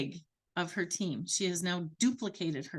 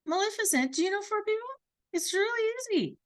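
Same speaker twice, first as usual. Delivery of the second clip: eager sales pitch; 11 semitones higher, faster than usual. Retold or imitated. Sally recruiting Maleficent.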